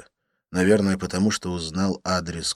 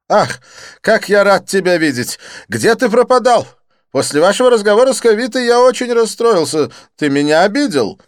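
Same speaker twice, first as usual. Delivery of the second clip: high, loud, theatrical French friend; excited Didier; 14 semitones higher, 12 dB louder than usual. Acted. Didier greeting his friend Lin, high excited gush.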